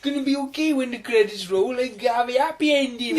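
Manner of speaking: high, naive put-on voice; deluded film believer. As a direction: play a hopeful simpleton.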